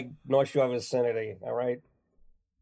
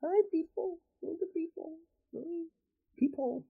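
high silly voice. Acted. Gushing at people onscreen.

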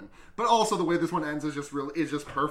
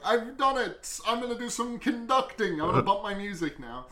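higher-pitched gleeful announcement; triumphant butler; 7 semitones higher than usual. Retold or imitated. Butler FaceTimes the family, crowing in triumph. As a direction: crow it in mock triumph.

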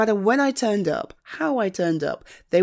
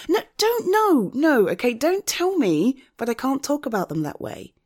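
higher, insistent protest; heated indignation; 8 semitones higher, 3 dB louder than usual. Acted. Friend defending someone rejected by parents, indignant. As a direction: raise the pitch, press each point home.

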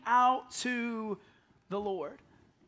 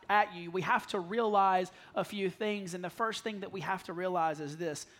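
ordinary talk

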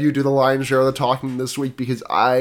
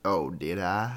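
low, soft mock-sultry DJ; deep radio voice; 5 semitones lower, 6 dB quieter, slower than usual. Podcaster answering southern remark in character, deep sultry voice. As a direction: play it coy in your deepest register.

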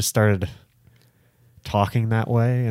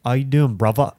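put-on British street accent, pitched up; mock London roadman greeting; playful urban British accent; 3 semitones higher than usual.

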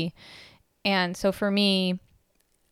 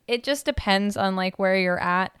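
ordinary talk

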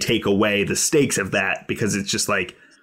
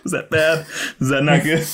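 laughing, a bit higher; 6 semitones higher than usual. Real, not acted. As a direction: giggle through the question. Laughing after crude joke.

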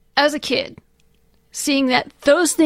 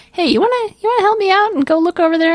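fast, higher-pitched casual ask; mock casual request; 7 semitones higher, 3 dB louder, much faster than usual. Hero asking his beasts to help him out, mock casual request. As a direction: breezily ask a favour in a quick, raised voice. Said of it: "the beasts only help out when they".

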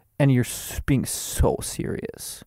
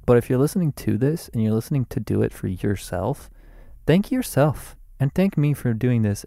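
ordinary delivery

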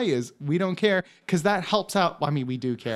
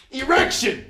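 a higher, punchy "Erection!" in place of objection; host mangles the courtroom cry for a laugh, shouted; joke objection shout; 6 semitones higher than usual. Shown as a demonstration. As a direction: bellow "Erection!" like a lawyer's objection. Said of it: "I'll do it".

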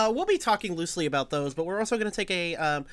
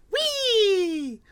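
high, long gleeful "wheee"; excited whee; 3 dB louder than usual. Pretend. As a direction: squeal whee like on a ride. Playful cheer going into the game.

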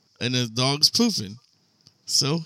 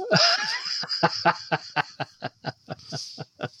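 high drawn-out laugh; 8 dB quieter than usual. Real, not acted. Laughing at story end.

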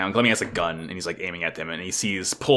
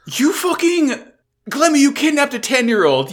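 much higher, louder indignant outburst; outraged yell; 6 dB louder than usual. Real, not acted. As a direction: yell at him in disbelief. Outraged at the character Glemmy.

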